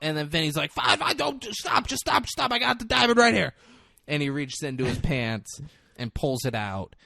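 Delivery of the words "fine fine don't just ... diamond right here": high, frantic pleas to stop; panicked pleading. Retold or imitated. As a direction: beg them to stop, panicking. Vinny panics, begs them to stop.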